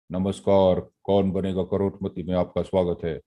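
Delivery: low, deep game-show host welcome; Amitabh Bachchan. Retold or imitated. Teacher showing students what a baritone voice is. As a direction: drop the voice into a deep baritone announcer. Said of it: "That is baritoneness".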